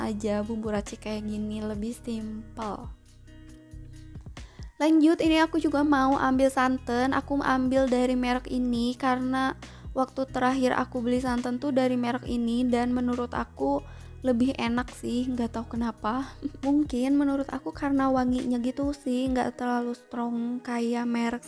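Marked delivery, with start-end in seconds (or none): none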